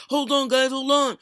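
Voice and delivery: loud, high mock urgency; urgent interruption. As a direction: imitate a streamer excitedly halting everyone. Acting out the streamer halting his game.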